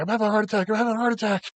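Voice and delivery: high-pitched mock-panicked cry; hammed-up high-pitched alarm. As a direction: imitate someone panicking, voice jumping up. Retelling a man's panic.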